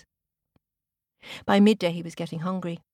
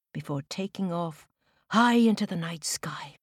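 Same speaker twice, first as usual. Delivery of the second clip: usual speaking voice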